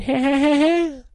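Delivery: high-pitched, drawn-out mock cackle; high cartoon cackle. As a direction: cackle like a mad cartoon character. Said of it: "he's just screaming away".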